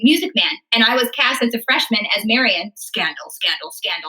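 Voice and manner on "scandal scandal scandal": low, soft mock-scandalized murmur; mock scandal. Joking to the host about her freshman lead, mock-scandalized and soft. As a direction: hush it like juicy gossip.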